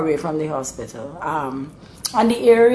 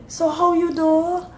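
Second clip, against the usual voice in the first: concerned Bajan dialect; 11 semitones higher than usual. Acted. A Bajan asking after someone long unseen, concerned Bajan dialect. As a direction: fuss over them in broad Bajan. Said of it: "If it's real concern, they would say".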